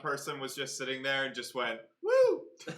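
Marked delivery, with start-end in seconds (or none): 2.0-2.4 high whooping cheer. Retold or imitated. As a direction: cheer alone at the computer. Imagined viewer cheering alone.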